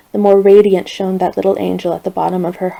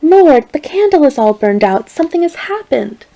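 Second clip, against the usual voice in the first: higher, much louder shocked cry; alarmed exclamation; 6 semitones higher, 5 dB louder than usual. A narrator's reading. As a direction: gasp it out at the doorway. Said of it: "and exclaimed".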